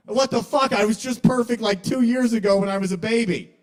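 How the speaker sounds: put-on loud, high-pitched childish protest; acted indignant outrage. Five-year-old comic self told he's in trouble, loudly indignant. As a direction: protest like a kid who's been wronged.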